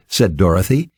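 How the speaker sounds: plain reading voice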